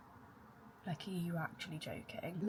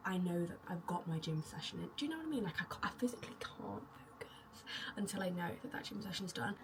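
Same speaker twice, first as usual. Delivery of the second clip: usual speaking voice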